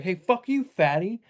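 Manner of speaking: high mock road rage yell. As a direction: yell the insult out the car window. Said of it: "yelling at these people".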